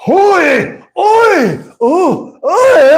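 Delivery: high mock yelps of alarm; shocked yelps. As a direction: yelp repeatedly, imitating a horrified man. Imitating man shocked by naked woman.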